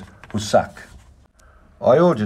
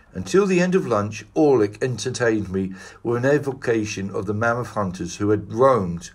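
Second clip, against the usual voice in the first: ordinary delivery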